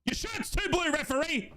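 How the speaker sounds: high put-on voice jeering the ref; a fan taking a cheeky shot at the referee; high mocking taunt; mock heckle. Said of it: "Let's all have a crack".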